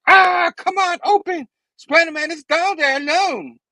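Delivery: high, loud, desperate plea; hammed-up anguished urging; acted frantic urgency. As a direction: strain and cry out in mock panic. Miss Marvel struggling to open cover.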